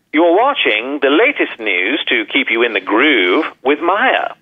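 light British accent, slow and careful. Shown as a demonstration. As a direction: give the show title in a British accent. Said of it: "in your accent".

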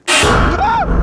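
very high shriek breaking into breathless laughter; scream, then laugh off the shock; scream then panicked laugh. Real, not acted. Jump scare.

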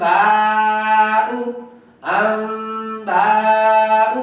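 আম্বাউ with a long hummed m and stretched বা; nun turned to mim. Shown as a demonstration. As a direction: chant আম্বাউ very slowly, holding the m. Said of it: "নুনের উচ্চারণ মিম হয়ে যাবে". (আম্বাউ-এর ম গুন্নাসহ লম্বা করে টেনে)

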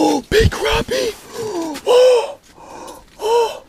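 very high-pitched gasping whoops; real excited shrieking. Real, not acted. Angler pulling up a big crappie, shrieking with excitement. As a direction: shriek with gasps at the catch.

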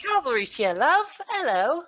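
high, loud put-on British voice; Tracer. Player trying Tracer's British catchphrase, high and loud. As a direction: swoop up high in mock British. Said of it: "I mean, Tracers, I can't do".

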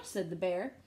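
ordinary delivery

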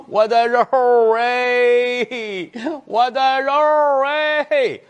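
high rising comic lament; mock wailing. Old mother cries for her children in court, mock wailing. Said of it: "老太太哭".

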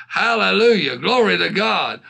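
ordinary talk, nothing marked

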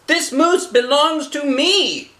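high, slow earnest little voice; Wilfred explaining. Acted. Wilfred correcting the old lady, high and earnest. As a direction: insist politely it's your moose. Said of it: "he explained".

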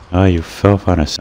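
ordinary talk, nothing marked

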